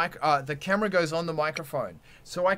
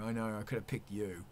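muttered aside; 10 semitones lower, 13 dB quieter, faster than usual. Real, not acted. Muttered aside after admission.

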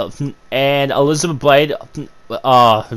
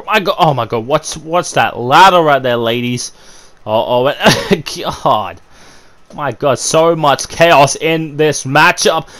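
ordinary talk, nothing marked

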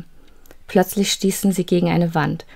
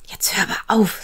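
angry whisper; 3 semitones higher than usual. Acted. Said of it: "sagte K wütend".

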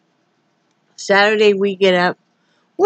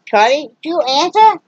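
pet talk; 7 semitones higher than usual. Real, not acted. Talking to the pet in baby voice.

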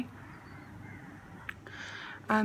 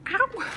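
high, loud yelp; sudden ow of pain; 5 dB louder than usual. Real, not acted. Interrupted while showing presents, a pained yelp. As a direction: yelp as if something just hurt.

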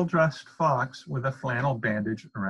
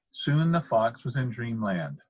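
ordinary delivery

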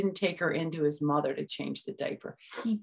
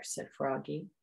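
ordinary delivery

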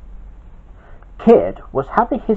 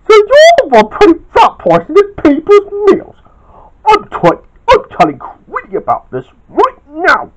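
much higher and louder angry shouting; furious accusation; 13 semitones higher, 8 dB louder than usual. Acted. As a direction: accuse him to his face. Kid confronts the culprit.

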